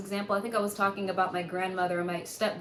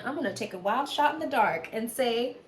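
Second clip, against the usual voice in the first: ordinary delivery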